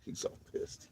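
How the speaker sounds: breathy whisper. Real, not acted. Whispering about the annoyed dog.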